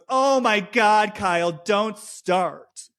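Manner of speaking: slow, drawn-out imitation of exasperation; put-on "here we go" complaint; mock exasperated groan. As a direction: start high, then drag it out wearily. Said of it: "Sierra immediately groans".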